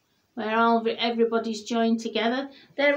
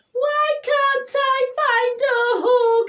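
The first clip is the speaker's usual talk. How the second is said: high, loud sing-song lament; sad sing-song wail; 6 dB louder than usual. A narrator's reading. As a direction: wail it like a sad little bear. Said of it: "he said".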